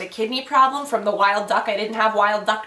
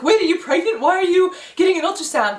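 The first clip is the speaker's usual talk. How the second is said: quoted surprise, pitched higher; mock-surprised questioning, raised in pitch; surprised friend; 7 semitones higher, 4 dB louder than usual. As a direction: act out a friend's startled question. Quoting a friend's surprised text.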